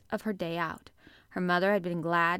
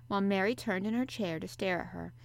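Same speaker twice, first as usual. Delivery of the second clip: plain reading voice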